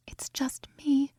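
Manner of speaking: breathy mouthed words, barely voiced; mouthed whisper. A narrator's reading. Evie mouthing to Aurora on the balcony, silent whisper. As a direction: hush it so only she can read your lips. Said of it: "Evie mouthed at her".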